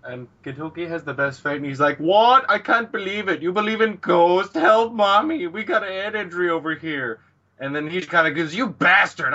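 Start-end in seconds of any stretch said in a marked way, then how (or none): 2.0-7.2 high, loud hammed-up outcry; mock disbelief. Gintoki ridiculing belief in ghosts, mock alarm. Acted mocking disbelief, calling for help. 8.5-9.3 raised, loud mock outrage; acted anger. Hichikata cursing at Gintoki, acted anger.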